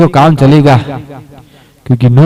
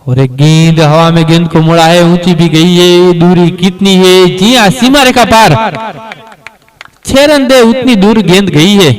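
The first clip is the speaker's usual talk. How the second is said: excited cricket commentary; 4 semitones higher than usual. Acted. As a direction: build the excitement as the ball flies. Six hit, commentator builds up.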